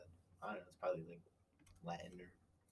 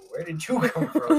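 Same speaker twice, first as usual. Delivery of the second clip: higher, louder, drawn-out baffled question; mock bewilderment; 7 semitones higher, 8 dB louder, slower than usual. Imagining meeting a kangaroo on the deck, bewildered. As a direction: act baffled, slow and loud.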